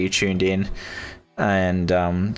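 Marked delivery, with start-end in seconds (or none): none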